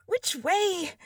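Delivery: Alice, alarmed, asking herself; anxious. Acted. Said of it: "said anxiously to herself".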